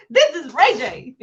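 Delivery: loud, laughing through it; laughing quote. Retold or imitated. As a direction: blurt his name while cracking up. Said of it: "He responded".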